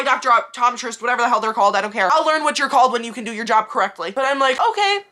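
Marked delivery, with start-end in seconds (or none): 4.6-5.0 high, slow, sugary thank-you; fake polite thanks. Patient thanking the receptionist with high fake sweetness. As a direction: sweeten it to hide the annoyance.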